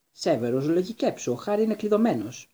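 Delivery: high-pitched, agitated appeal; exasperated plea. A narrator's reading. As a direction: urge him to see reason. Angry argument, urging reason.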